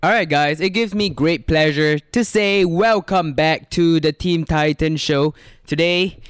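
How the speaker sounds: loud, slow, upbeat host welcome; show host intro. Acted. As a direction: announce it like a show opener.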